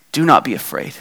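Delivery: calm their fear softly; Jesus quietly comforting the frightened women; tender reassurance. Acted. Jesus' real words after mock rebuke.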